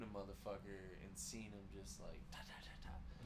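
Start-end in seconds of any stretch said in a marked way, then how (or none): 2.0-3.3 whispered gunfire. Retold or imitated. Imitating gunfire.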